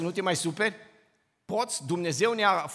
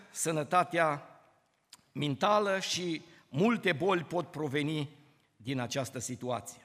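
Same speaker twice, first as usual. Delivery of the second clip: ordinary talk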